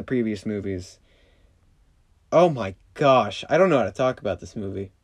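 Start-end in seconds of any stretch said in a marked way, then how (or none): none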